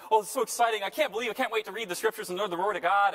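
high, loud, gushing with excitement; excited new believer. Retold or imitated. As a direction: bubble over like an eager new convert. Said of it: "he's really excited".

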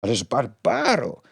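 a slightly slow, shocked exclamation; a lightly voiced protest; mild exclamation. A narrator's reading. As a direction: Hastings mildly scandalised at Barbara. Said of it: "wykrzyknął Hastings".

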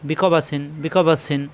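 "বি ক বা সিন" joined up with short vowels; quick short vowels. Shown as a demonstration. Teacher showing vowels must be quick, joined-up short syllables in "বি ক বা সিন". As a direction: run "বি ক বা সিন" together without dragging. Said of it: "হরকতের উচ্চারণ তাড়াতাড়ি".

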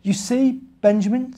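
a hint of a wise mother, high lift on the name; gentle Mother Bear. Mother Bear teaching Benjamin, lightly voiced gentle mother. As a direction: coax him toward the lesson.

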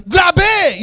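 high, falling cry; hype shout. Acted. Recruit echoing a slogan from someone unseen, hype shout. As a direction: shout back the slogan on reflex. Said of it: "nagagrabe siya".

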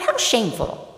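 shocked, pitched very high; horrified. Retold or imitated. Preacher recalls judging the nine, horrified. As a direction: act out scandalised horror, leaping up in pitch. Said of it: "I was horrified".

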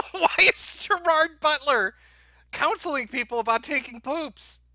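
laughing disbelief. Real, not acted. Streamer reacting to Gerard Butler news, laughing. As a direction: laugh through the question in disbelief.

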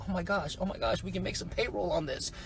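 soft, barely contained excitement; acted-out quiet amazement; hushed delight. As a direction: say it under the breath, thrilled. Retold delight at first sales.

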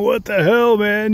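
high, outraged yelling; a mock tantrum pitched high; old McEnroe flipping out. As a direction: squeal it like a furious old man. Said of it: "He'd be flipping out".

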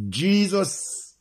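ordinary talk, nothing marked

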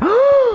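a sudden shrill scream of fright; piercing high scream; startled scream. Real, not acted. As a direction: yelp as if something jumped at you.

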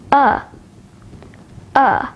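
short "A" as in blood; quick, isolated "A", the vowel of duck; short vowel demo. Shown as a demonstration. Teacher showing learners the short vowels, "A" said short. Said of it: "Take a look at the short vowels".

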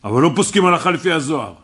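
loud, fast indignant objection; angry outburst. Retold or imitated. Student bursting into the rabbi's lesson, loud angry protest. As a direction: blurt it out like an offended student cutting in. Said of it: "אז הוא התפרץ".

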